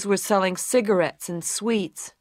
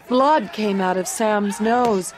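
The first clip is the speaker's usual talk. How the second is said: usual speaking voice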